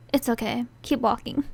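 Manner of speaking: usual speaking voice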